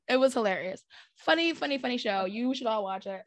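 ordinary talk, nothing marked

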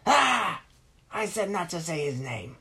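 put-on villain yelling at the boy. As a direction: shout the rule back at him.

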